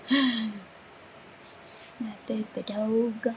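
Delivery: soft, drawn-out whispered catchphrase; hushed and very slow. Pretend. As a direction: stretch it out in a whisper. Repeats catchphrase slowly, whispering.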